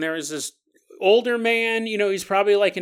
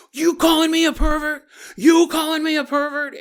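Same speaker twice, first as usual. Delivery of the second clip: raised, high-pitched confrontational yell; loud angry accusation; 10 semitones higher, 6 dB louder than usual. Retold or imitated. Young man confronts old man in Starbucks, loud accusation. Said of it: "And it's so loud".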